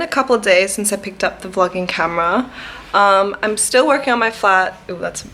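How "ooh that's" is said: interrupted by a hiccup; talking through the hiccups. Real, not acted. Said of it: "I have the hiccups actually".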